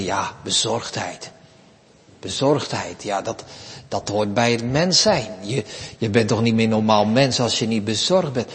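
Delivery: grumbling imitation of the fretful believer; mock resigned grumble. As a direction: shrug it off like a worried churchgoer. Mimicking worried churchgoers' excuse.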